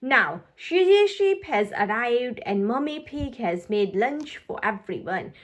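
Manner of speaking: plain reading voice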